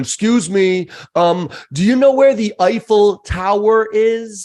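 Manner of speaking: loud, slow, dumb American tourist; over-enunciate like they're deaf; mock loud slow tourist. Mocking friends' tourist manners to podcast host, loud slow mock tourist. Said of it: "typical dumbass American thing".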